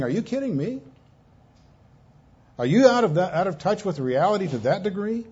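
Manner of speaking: can't believe what they're doing; incredulous. Retold or imitated. God asking his people in disbelief, incredulous. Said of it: "It's as if God is saying".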